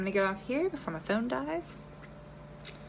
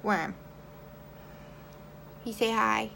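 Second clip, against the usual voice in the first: ordinary talk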